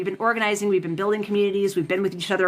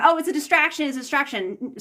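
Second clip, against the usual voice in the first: high mock parroting of what everybody says; mocking quote; 6 semitones higher than usual. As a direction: parrot the cliché, higher and sarcastic. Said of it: "everybody throws around this term".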